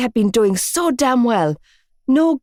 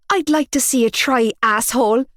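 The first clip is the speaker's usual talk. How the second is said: screamed back; 5 semitones higher than usual. A narrator's reading. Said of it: "I screamed back".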